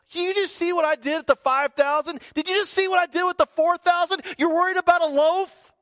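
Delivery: high, loud mock exasperation; exasperated Jesus. Jesus scolding the worried disciples, mock exasperation. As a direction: throw up your hands at them. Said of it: "Jesus is like".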